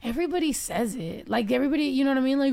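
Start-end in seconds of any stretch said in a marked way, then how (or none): none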